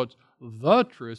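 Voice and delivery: slow, weighty stress on "the"; "the" set apart, slowly; emphatic THE. Shown as a demonstration. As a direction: stretch and punch "the" before "truth". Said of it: "the truth in the Greek".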